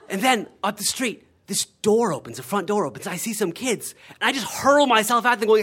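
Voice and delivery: usual speaking voice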